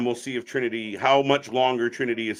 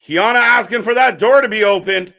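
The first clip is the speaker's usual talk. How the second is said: excited commentary; 8 semitones higher, 9 dB louder than usual. Real, not acted. Live commentary on cage fight.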